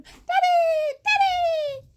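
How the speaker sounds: high, loud delighted calls; excited calling. Acted. Daughters greeting their father home, high excited calls. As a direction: call out as you run to him.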